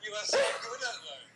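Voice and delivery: high, loud words squeezed through real laughter; laughing hard. Real, not acted. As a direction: crack up while praising him. Host cracks up at mock accent.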